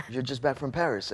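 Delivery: light imitation of a French accent; quoting Julian with a hint of French accent. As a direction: guest mimics the painter's French accent. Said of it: "says to me in his French accent".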